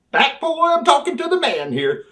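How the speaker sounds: high, loud dismissive line; high brush-off. Retold or imitated. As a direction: wave him off, high and loud.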